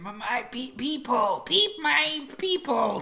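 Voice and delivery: high stuttering little voice, drawn out; high-pitched trembly stutter; chattering, stammering Burb. Acted. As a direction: stammer it high, tripping on the words. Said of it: "you can hear him, like, chattering".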